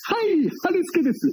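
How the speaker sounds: very high, drawn-out, over-the-top greeting; high and slow, excitable impression; 春助師匠. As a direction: imitate a phone greeting with huge energy. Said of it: "テンションがさ、すごいんだ、春助師匠って".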